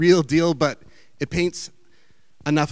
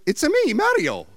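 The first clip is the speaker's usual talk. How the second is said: higher, bouncy cartoon Italian plumber; Mario impression; 12 semitones higher than usual. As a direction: toss off a quick Mario catchphrase. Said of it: "is Mario from Nintendo".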